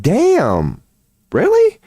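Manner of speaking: stunned, squeaky really; shocked disbelief. Retold or imitated. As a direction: voice leaps up on really. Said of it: "and then they're like".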